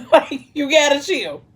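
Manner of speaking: laughing, high-pitched plea; amused scolding. Real, not acted. Reader to Alani the certified nutcase, laughing and exasperated. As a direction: laugh while telling her off.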